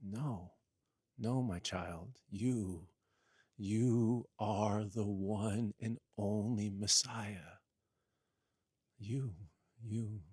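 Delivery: tender voice of God. Acted. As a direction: soothe him like a loving father. God's voice gently reassures Jesus.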